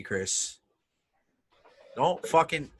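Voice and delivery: normal talking voice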